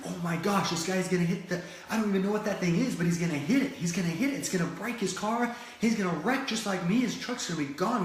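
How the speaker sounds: high, loud panicky rush; freaking out. Retold or imitated. Stranded driver fearing the truck will wreck, panicked and high. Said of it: "he's freaking out".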